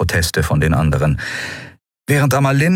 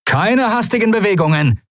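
raised, higher warning call. A narrator's reading. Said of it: "rief er den Weißmänteln zu".

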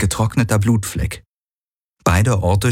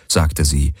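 usual speaking voice

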